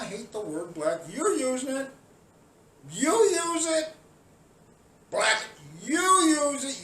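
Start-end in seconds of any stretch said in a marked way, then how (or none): 1.1-3.9 raised, loud accusing voice; angry rant. Real, not acted. Ranting at protesters, loud and accusing. 5.1-6.8 raised, loud accusing voice; angry rant. Real, not acted. Ranting at protesters, loud and accusing.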